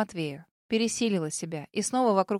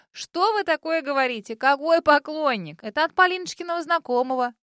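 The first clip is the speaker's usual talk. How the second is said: mother voice; 6 semitones higher, 5 dB louder than usual. A narrator's reading. Mother brushing off jokes.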